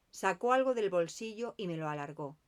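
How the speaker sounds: ordinary delivery, nothing marked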